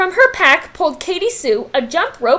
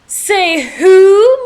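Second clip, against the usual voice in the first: higher, louder bully howl; 6 semitones higher, 7 dB louder than usual. A narrator's reading. Recess queen howling at the kids. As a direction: howl it out to rule the playground. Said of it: "Mean Jean howled".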